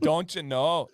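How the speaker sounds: Minnesota accent with a drawn-out round "know"; a hint of Minnesota nice. Shown as a demonstration. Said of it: "[name] says, "Minnesota".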